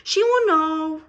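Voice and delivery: very loud, high joyful cry; happy shout. Acted. Another child spots the squirrel, a loud joyful shout. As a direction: shout with delight.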